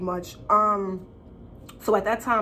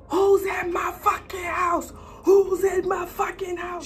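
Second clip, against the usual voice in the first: mom's furious shout; 9 semitones higher than usual. Retold or imitated. Mother storming out of the bathroom, furious high shout. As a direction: bellow it like an outraged mother.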